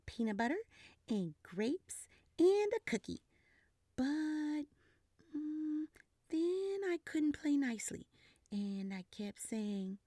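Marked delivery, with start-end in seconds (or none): none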